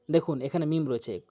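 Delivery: normal talking voice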